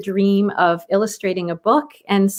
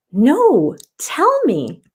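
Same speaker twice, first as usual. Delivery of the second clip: put-on higher eager little girl; a child begging to hear more; 5 semitones higher, much slower than usual. Girl urging Grandma to tell the story, high and eager.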